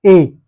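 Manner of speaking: ए held out as a long vowel; long drawn ए. Shown as a demonstration. Teacher to students, stretching ए as a long vowel. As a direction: stretch ए to two beats. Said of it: "खाली ए नहीं बोल सकते ना".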